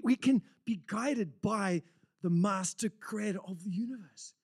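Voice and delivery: breathless high enthusiasm; excited. Real, not acted. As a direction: lift up with sheer excitement. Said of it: "I got excited".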